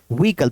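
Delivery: व्हीकल said "vee-kal", two syllables; correct silent H. Shown as a demonstration. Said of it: "हे का word नहीं निकलेगा".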